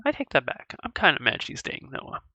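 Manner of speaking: ordinary talk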